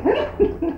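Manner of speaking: high burst of laughter. Real, not acted. The old worker laughing at his story's punchline, high. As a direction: laugh at your own punchline.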